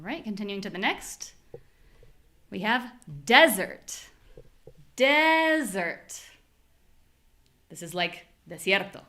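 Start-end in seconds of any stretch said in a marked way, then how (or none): 3.2-4.1 "desert" with a strong DES; stressed first syllable in "desert". Shown as a demonstration. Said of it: "make the syllable that is strong sound strong". 5.0-6.2 "Desert" with a high, stretched DES; exaggerated long first syllable in "Desert"; first-syllable stress. Shown as a demonstration. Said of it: "make the syllable that is strong sound strong".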